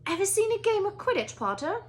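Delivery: bursting with enthusiasm; young captain, thrilled by a new player; excited Wood. Acted. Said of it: "he asked excitedly".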